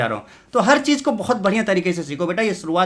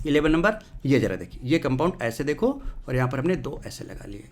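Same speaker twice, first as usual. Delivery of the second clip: usual speaking voice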